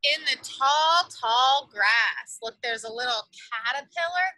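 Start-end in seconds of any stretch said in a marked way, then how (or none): none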